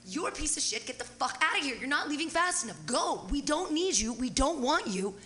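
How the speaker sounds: high-pitched re-enacted angry tirade; retold yelling. Host retells kicking the guy out, ranting angrily. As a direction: fire off insults, acting out the row. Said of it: "I got to yell at dirty hippies".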